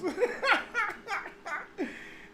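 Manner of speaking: a high, squealing burst of laughter; shrill giggle fit, real. Real, not acted. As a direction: crack up at his own joke. Laughing at his own joke.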